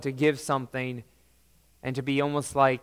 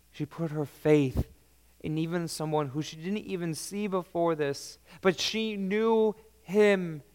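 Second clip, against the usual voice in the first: ordinary talk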